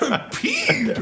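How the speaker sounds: high-pitched, laughing imitation; mock the mild word through laughter; amused, squeaked-up quote; laughing mockery. Mocking partner's mild word, laughing.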